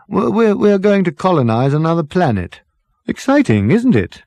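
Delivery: breezy dotty captain waving off worry; jolly captain. Acted. Captain laughing off Ford's worry about the frozen bodies, jolly.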